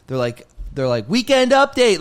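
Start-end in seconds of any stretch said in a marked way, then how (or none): 1.1-2.0 loud, high heckler yell; crowd yelling. Retold or imitated. Rowdy casino crowd heckling the comedian, loud high yell. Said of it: "they're just yelling out".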